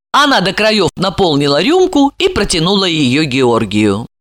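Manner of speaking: plain reading voice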